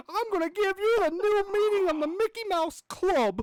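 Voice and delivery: very high, loud squeaky cartoon mouse shouting; Mickey voice. Still in the Mickey voice, yelling insults. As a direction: screech it like an angry cartoon mouse. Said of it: "get Mickey out of here".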